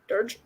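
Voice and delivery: "Dirge" in one short syllable, DURJ; questioning pronunciation. Shown as a demonstration. Reader tests a word, "Dirge" as one questioning syllable DURJ. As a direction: try "Dirge" as DURJ, asking. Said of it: "No idea".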